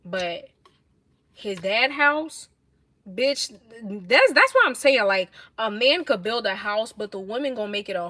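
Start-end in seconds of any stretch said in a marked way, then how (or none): none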